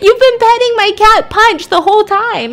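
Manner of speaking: loud, high-pitched mock shock; high, loud retold exclamation; incredulous outcry. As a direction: cry out in hammed-up disbelief. Retold shock at allergy confession.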